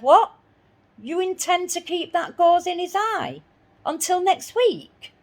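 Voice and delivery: an appalled shriek of disbelief; outraged disbelief. Retold or imitated. Telling the vet off about the gauze, in shocked outrage.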